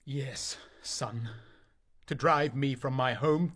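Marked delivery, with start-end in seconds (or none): 0.0-1.3 hushed, breathy-free whisper. Acted. Father whispers his answer to his son.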